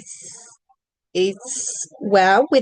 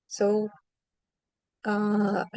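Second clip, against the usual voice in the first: ordinary talk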